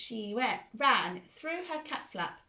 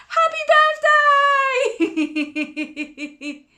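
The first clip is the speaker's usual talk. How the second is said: squeaky birthday wish; 12 dB louder, slower than usual. Pretend. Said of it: "Vicky says".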